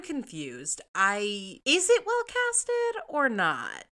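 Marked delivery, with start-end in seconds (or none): 1.6-3.9 slow, high, disbelieving question; exasperated. Real, not acted. Host mocking a contradictory review, slow and incredulous. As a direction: drag it out in disbelief.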